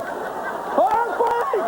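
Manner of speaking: high-pitched shriek of alarm; mock earthquake alarm. Guys spotting the first sign of a tremor, high mock alarm. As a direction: shout out a warning in a squeaky high pitch. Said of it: "guys go".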